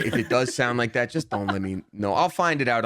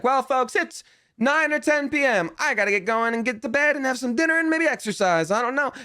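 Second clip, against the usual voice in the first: much higher, cheery wrap-up voice to the audience; streamer sign-off; 12 semitones higher than usual. Retold or imitated. Streamer signing off to his chat at night, mock chipper voice.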